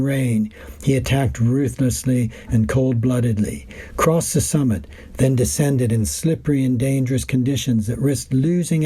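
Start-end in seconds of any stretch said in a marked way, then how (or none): none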